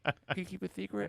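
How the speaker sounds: put-on high little boy voice with a lisp; kid voice. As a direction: ask like a shy small child. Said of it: "start doing kid voices".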